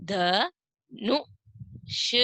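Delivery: Dhanush drawn out very slowly; slow first take. Shown as a demonstration. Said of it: "अगला चित्र है".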